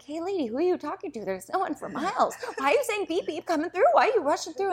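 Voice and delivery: high, incredulous comic scolding; mock-indignant grandma. Grandma challenges the woman, retold.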